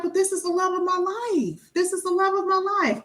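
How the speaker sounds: mock-pleading defense of a partner; insist, a little plaintive. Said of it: "And you're like".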